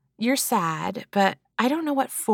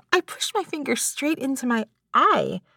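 ordinary talk, nothing marked

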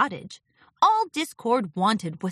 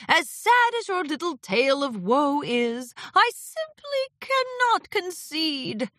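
shrugging refusal; 9 semitones higher than usual. A narrator's reading. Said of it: "Discord shrugged".

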